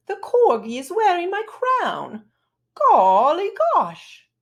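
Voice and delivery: high, loud prim queen; posh queen. Acted. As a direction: put on a dainty royal voice. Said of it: "You'd sound high-pitched, dainty, and posh".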